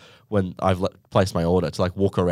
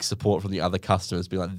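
ordinary delivery

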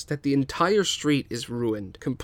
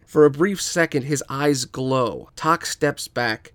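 usual speaking voice

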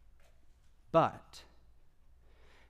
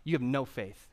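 ordinary talk

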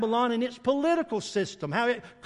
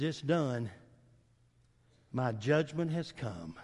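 softer, lower quiet pronouncement; hushed judgment; 8 semitones lower, 8 dB quieter, slower than usual. Acted. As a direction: say it low and final, like a sentence passed. He says.

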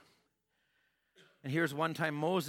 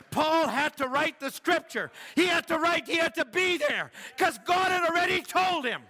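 higher and louder, building to a shout; full-force preaching; 7 semitones higher, 6 dB louder than usual. Real, not acted. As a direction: preach it home with rising fire.

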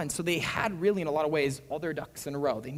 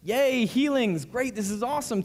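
mock cheering; 8 semitones higher, 5 dB louder than usual. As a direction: parody the scribes' delight. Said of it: "what made these men go".

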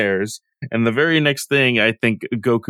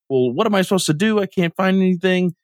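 higher-pitched complaint, lightly acted; mock exasperation; 7 semitones higher than usual. Bulma complains to Oolong she has nothing to wear, mock exasperation. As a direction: imitate a helpless, put-out protest.

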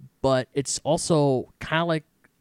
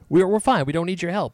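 high, louder mock brush-off; dismissive refusal; 5 semitones higher, 5 dB louder than usual. A kingdom turning down the God of Whispers' help, mock dismissive. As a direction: imitate them waving off help, pitched up.

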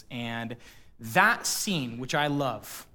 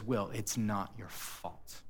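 slow tender reassurance; 4 semitones lower, 8 dB quieter, slower than usual. Retold or imitated. Therapist steps closer, repeats.

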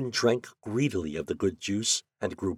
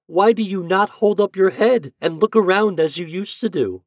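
high, loud questioning sunbeams; high storybook cry of concern; 10 semitones higher, 6 dB louder than usual. A narrator's reading. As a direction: ask it brightly pitched up, like calling to a small friend. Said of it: "they cried".

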